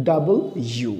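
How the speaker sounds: British "ডাবল ইউ" in two clear words. Shown as a demonstration. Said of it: "ব্রিটিশরা বলবে". (British উচ্চারণে দুই শব্দে "ডাবল ইউ")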